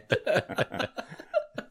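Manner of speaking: breathless high laughter; high wheezy laugh. Real, not acted. Cohost laughing at his own mouth joke, high wheezy laugh.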